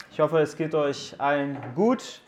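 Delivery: ordinary delivery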